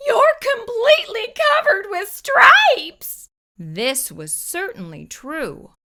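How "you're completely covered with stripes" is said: high, loud alarmed exclamation; shocked cry. Acted. Mother finding her daughter covered in stripes, shrill loud alarm. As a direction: gasp it out in disbelief. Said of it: "she cried".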